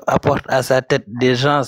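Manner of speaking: ordinary talk, nothing marked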